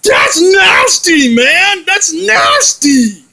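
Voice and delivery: slow, loud, high disgusted cry; disgusted yell. Acted. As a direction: drag it out in total disgust. Disgusted at poop news.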